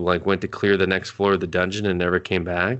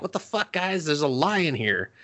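higher, panicky alarmed father; alarmed dad; 7 semitones higher than usual. Retold or imitated. Dad panicking at the lion in the house, high and alarmed. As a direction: squeal it like a scared dad.

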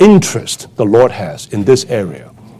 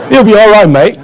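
mock Australian accent, louder and higher; louder Australian saying; 7 semitones higher, 4 dB louder than usual. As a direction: ham up the carefree Aussie line. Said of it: "the typical saying in Australia".

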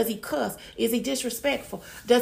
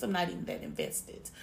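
normal talking voice

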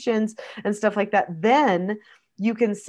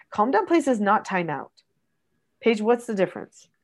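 normal talking voice

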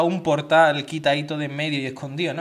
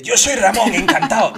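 loud, laughing hearty introduction; loud greeting; 5 semitones higher, 10 dB louder than usual. Retold or imitated. Stranger introducing himself, loud and hearty. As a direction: boom out your name to a stranger.

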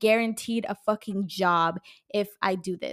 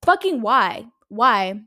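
loud, exasperated why; angry outburst; 8 dB louder, much slower than usual. Real, not acted. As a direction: blurt it out in frustration. Ranting about unfair life.

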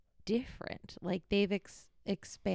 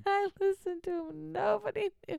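mock crying; 13 semitones higher than usual. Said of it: "you can always say in the future".

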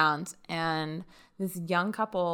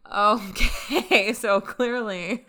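laughing; 3 semitones higher, 4 dB louder, much slower than usual. Real, not acted.

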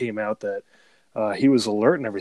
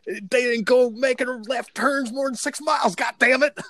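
hillbilly voice; 13 semitones higher than usual. Retold or imitated. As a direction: whine it like an outraged hillbilly. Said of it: "And you have to say".

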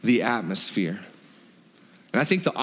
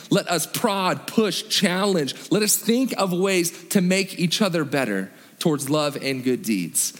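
ordinary delivery

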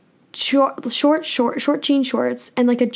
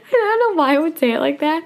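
laughing; 5 semitones higher than usual. Real, not acted. Laughing after the demo.

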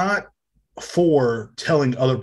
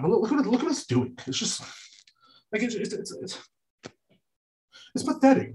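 exasperated; 9 semitones higher, 5 dB quieter than usual. Real, not acted. Showing the drooping light.

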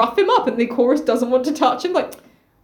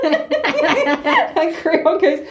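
normal talking voice

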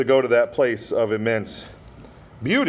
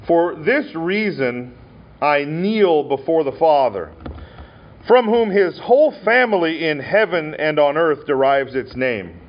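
slow, solemn, louder Bible reading; scripture reading; 3 semitones higher, 3 dB louder than usual. Acted. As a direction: lift the verses up slowly for the congregation. Reads scripture aloud.

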